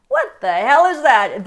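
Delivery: loud and slow, with disbelief; slow, loud what-the-hell disbelief; loud exasperated outburst. Real, not acted. Reacting to her safe answers.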